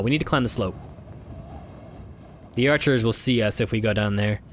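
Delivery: ordinary talk, nothing marked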